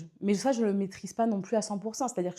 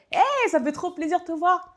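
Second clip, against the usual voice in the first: bright, high and louder greeting; happy to see you; 10 semitones higher, 6 dB louder than usual. Shown as a demonstration. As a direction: greet a friend you're thrilled to see. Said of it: "vous arrivez en mode". (salut enthousiaste, aigu et plus fort)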